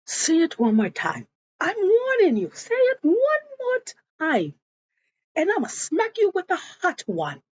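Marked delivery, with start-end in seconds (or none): 1.6-7.4 escalating threat. Retold or imitated. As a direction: act out a mother at her limit. Mother threatens a smack, escalating.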